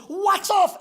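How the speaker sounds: high, loud martial cry; blocking shout. Acted. Student blocking the sensei's hit, high loud shout. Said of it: "Ralph Macchio goes".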